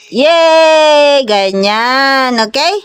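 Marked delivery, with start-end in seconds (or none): 0.1-1.2 a bright, drawn-out little cheer. Real, not acted. Cheering the child's drawing step. (masayang pagbubunyi)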